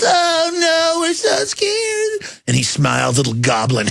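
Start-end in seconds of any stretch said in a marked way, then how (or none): 0.0-2.4 much higher, whimpering fake fright; mock terrified crowd. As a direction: wail it like a hammed-up scared crowd. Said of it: "And then everybody rise".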